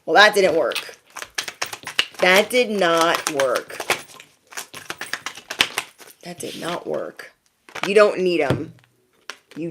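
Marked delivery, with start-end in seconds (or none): 2.2-3.8 slow, deliberate verdict; emphatic. Real, not acted. Telling besties the prying failed, slow and emphatic. 6.2-7.3 much softer, understated verdict; quiet aside. Real, not acted. Telling besties the prying failed, quiet aside. Repeats verdict again, dropping away.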